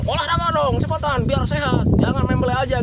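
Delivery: high, loud, pushy nagging; exasperated relative giving orders; nagging family. Retold or imitated. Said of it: "ketika keluarga selalu bilang".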